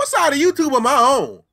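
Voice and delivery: loud, high disbelieving yell; baffled outburst. Real, not acted. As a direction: shout it in total disbelief. Baffled reaction to weird clip.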